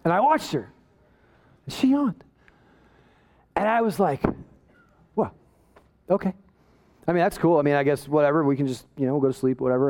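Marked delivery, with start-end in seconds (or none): 5.2-9.9 low, half-hearted "that's cool"; low sulky mumbling retreat; sulky dismissal. Retold or imitated. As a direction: act hurt but pretend not to care. Feigning indifference after wife yawns.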